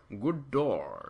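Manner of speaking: "Goddard" drawn out with a long second syllable; exaggerated, falling "Goddard"; playful name pronunciation. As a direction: stretch the end of "Goddard". Playing with the director's name.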